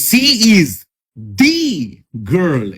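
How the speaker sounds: "the" set apart as a long "dee"; pause, hit "the" as "DEE", pause; stress on THE. Shown as a demonstration. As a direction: isolate "the" with pauses and force. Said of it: "এরকম করে জোর দিয়ে বলতে".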